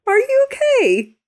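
very high, loud check on a crying friend; real, high-pitched alarmed sympathy; startled concern. Real, not acted. Friend reacts to host's sudden tears.

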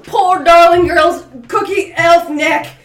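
laughing shout of a ruling; shouting verdict. Acted. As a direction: bellow the verdict through laughter.

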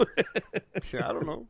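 slow high mock.

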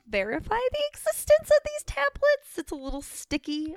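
high put-on mysterious voice; dramatic voice. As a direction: tease it in a high voice. Teasing dramatic tone shift.

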